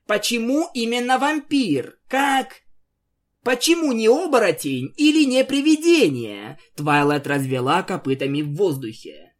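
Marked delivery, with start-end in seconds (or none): 0.1-6.6 baffled. Acted. Twilight baffled, throwing up hooves.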